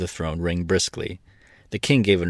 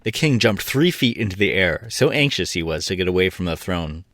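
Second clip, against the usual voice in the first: usual speaking voice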